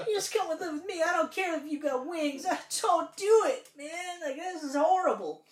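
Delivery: high, loud, hammed-up anguished plea; pleading with the screen. Viewer pleading with the crying kid on TV, loud and overwrought. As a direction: cry out to a movie character, mock-desperate. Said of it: "reaching to the camera, like, like, into the T V".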